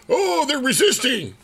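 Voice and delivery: high-pitched mock outrage; mock government alarm. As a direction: exclaim it in put-on alarm. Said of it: "government interpreted that as".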